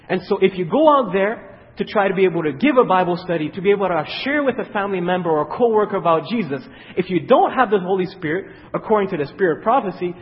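loud, fervent preaching; preaching build. Acted. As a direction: drive it home to the congregation. Preaching at full force.

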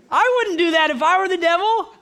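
very high, loud squeal of disbelief; incredulous high-pitched protest. Real, not acted. Preacher dismissing his own scare-tactic example, high incredulous outburst.

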